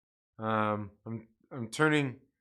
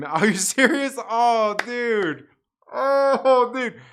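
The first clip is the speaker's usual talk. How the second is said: thrilled laughing outburst; 9 semitones higher, 7 dB louder, much slower than usual. Real, not acted. Caller reveals the scam call video.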